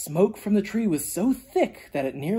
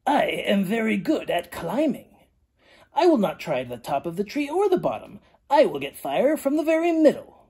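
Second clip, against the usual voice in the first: snake character; 4 semitones higher than usual. A narrator's reading. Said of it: "told them his plan".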